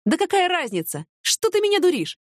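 high, incredulous rebuke; indignant jealous partner; rising indignation. Acted. Man flares up.

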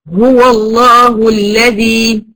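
slow recited هو الله with a full, heavy emphatic lam in الله; correct heavy lam. Shown as a demonstration. Teacher reciting slowly to a student, heavy lam. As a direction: stretch الله with a thick, velarized lam after the open waw. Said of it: "أما أقول".

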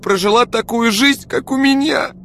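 very high, loud, tearful outburst; distraught mother, pitch soaring; sobbing plea. A narrator's reading. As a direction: plead as if breaking into sobs. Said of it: "всхлипнула она".

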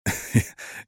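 brief, soft acted laugh; short laugh. A narrator's reading. Man laughs at her offer of sex, short chuckle. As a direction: let out a small, quiet chuckle. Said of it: "Он рассмеялся".

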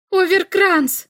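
high, loud calling out a name; shouting. A narrator's reading. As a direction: yell his name across the arena. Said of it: "крикнула Ульма".

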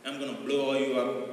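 mock American bomb threat, measured and level; lightly voiced American villain on a big screen; hint of an American movie baddie; American villain accent. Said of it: "if someone from America calls the U N".

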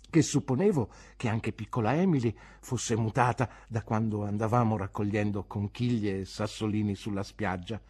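ordinary delivery, nothing marked

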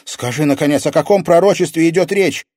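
put-on high, loud girl's question; urgent, pressing plea; exasperated demand. A narrator's reading. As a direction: insist on an answer at last. Exasperated girl demands an explanation.

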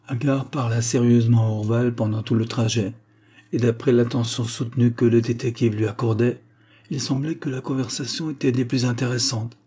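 ordinary delivery

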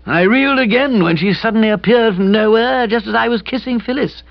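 sheepishly explaining a blunder; gloomy young man voice. A narrator's reading. Kipper recounts.

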